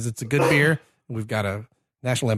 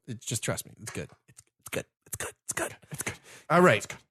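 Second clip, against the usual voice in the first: fading repeated murmur; 5 semitones lower, 8 dB quieter, faster than usual. Real, not acted. Repeating fading "it's good.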